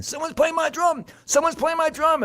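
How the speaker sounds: very high, loud outraged cat cry; alarmed cat. A narrator's reading. Cat hears his drum from the field, high alarmed yell. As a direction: squawk it out in high-pitched alarm. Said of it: "Cat comes running and saying".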